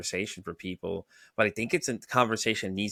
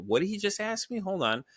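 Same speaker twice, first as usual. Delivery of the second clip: higher, puzzled double take; imagined surprise; 10 semitones higher than usual. Retold or imitated. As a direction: pretend to be caught off guard by the question. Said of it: "It makes you think yourself".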